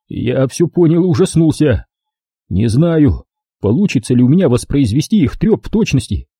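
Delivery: ordinary delivery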